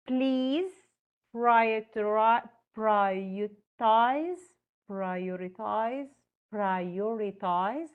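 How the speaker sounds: prioritize stretched very slowly, syllable by syllable; teacher drills the word prioritize for learners, slowly; slow, drawn-out prioritize. Shown as a demonstration. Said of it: "Here is an example".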